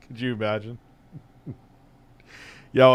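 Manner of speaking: laughing. Real, not acted. Laughing at Windows 3.1 joke.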